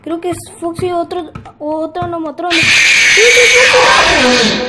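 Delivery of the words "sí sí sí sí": loud drawn-out triumphant yes; excited shout. Real, not acted. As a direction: scream yes slowly. (grito eufórico y alargado)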